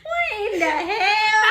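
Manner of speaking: loud high slow shocked shout; real stunned laughing outburst; shocked cry. Real, not acted. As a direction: cry out in disbelief. Shocked at makeup mess.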